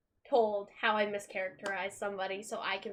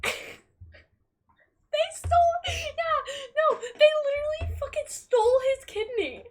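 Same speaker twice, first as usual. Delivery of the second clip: high, louder, strained exclaim; outraged disbelief; 4 dB louder than usual. Real, not acted. Fan recalling Charlie's stolen kidney, squealing in outrage.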